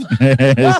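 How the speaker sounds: sudden loud laugh; bright laugh; real burst of laughter. Real, not acted. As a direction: laugh out at your own joke. Laughs at his mother joke.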